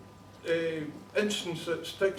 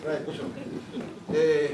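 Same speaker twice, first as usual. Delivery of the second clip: ordinary talk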